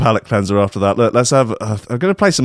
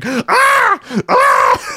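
very high-pitched comic shrieks; hammed-up high scream; horrified scream; 3 dB louder than usual. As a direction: scream in mock horror, twice. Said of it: "face just screaming".

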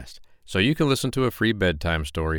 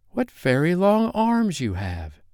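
put-on slow wondering girl; 9 semitones higher, slower than usual. Girl voiced.

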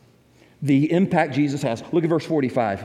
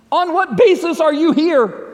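louder, higher, pressing interrogation; stern demanding angel; 10 semitones higher, 6 dB louder than usual. Acted. Supervisor angel questioning newcomer at heaven's gate, stern and loud. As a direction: demand an answer like a gatekeeper.

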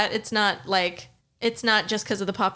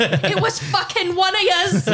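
mock Irish accusation; 8 semitones higher than usual.

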